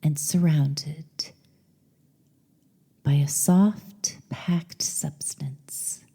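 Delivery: measured meditation voice with long pauses; guided meditation. Acted. Host leading listeners on a journey to the goddess, meditative. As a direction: guide the listener inward, lingering between phrases.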